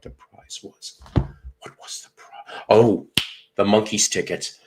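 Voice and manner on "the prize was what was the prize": whispered, slow self-questioning; talking to self. Real, not acted. Radio winner trying to recall his prize, whispering to himself. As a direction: ask it under your breath, searching your memory.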